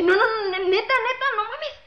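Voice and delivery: sube la voz, más aguda, sin creerlo; no lo puede creer, agudo y fuerte; emocionado incrédulo. Acted. Jesús emocionado en el chiste. (Jesús incrédulo, voz aguda y emocionada)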